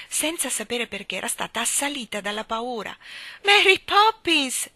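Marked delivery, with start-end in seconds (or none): 3.4-4.7 very high, slow, loud anxious call; anxious cry. A narrator's reading. Michael calling after Mary Poppins anxiously. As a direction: cry out for her in dread. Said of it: "gridò Michael con la stessa ansia".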